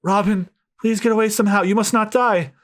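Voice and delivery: high pleading mother's voice; desperate mother. Acted. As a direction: beg the child to run. Mother urging Robin to flee.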